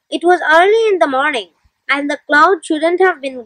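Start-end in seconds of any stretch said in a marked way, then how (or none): none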